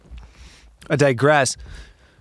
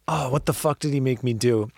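ordinary delivery

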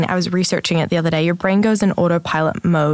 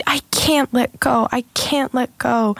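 higher, slow imitation of a clinging child; little girl pleading; 5 semitones higher, slower than usual. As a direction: plead gently like a small girl. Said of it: "the little girl's like".

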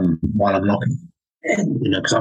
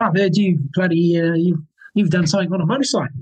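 imitated Kiwi, pitched up; Kiwi accent; 9 semitones higher than usual. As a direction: put on a curious Kiwi workmate. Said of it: "bit more of a Kiwi accent".

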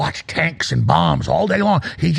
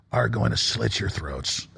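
ominous low hush; 9 semitones lower, 7 dB quieter, slower than usual. Real, not acted. Ominous threat in rant.

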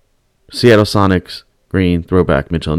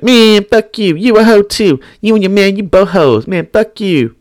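higher, sneering retold curses; mock drunk heckler; 9 semitones higher than usual. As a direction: play the rowdy fan mouthing off. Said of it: "And then he was like".

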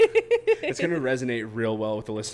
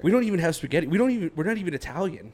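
higher-pitched retold protest; mock indignation; 7 semitones higher, faster than usual. Friend mimics Glenn protesting about spaghetti dinner, mock indignant. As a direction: imitate a baffled objection, pitched up. Retelling Glenn's baffled objection to Caitlin.

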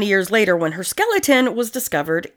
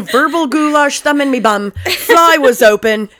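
hammed-up recap; 5 semitones higher, 4 dB louder than usual. Recapping killer story mockingly.

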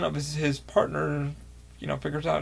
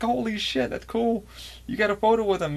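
excited fan; 7 semitones higher than usual. Retold or imitated. Geeking out to fan friends.

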